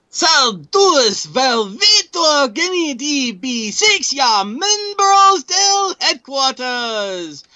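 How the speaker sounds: high, slow, hammed-up Mexican accent; exaggerated mock Mexican accent. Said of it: "say it in a semi, uh, insulting accent".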